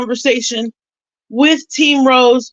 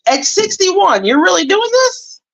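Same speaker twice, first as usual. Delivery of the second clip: incredulous; 7 semitones higher than usual. Retold or imitated. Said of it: "And I was just like".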